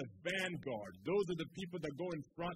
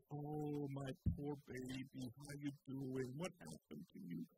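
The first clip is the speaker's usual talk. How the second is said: mock coddling; 2 semitones lower, 6 dB quieter than usual. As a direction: coo like soothing a hurt child. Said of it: "God hasn't come and coddled them and said".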